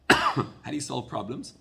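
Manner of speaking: ordinary talk, nothing marked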